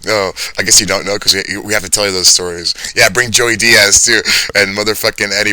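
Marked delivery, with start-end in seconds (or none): none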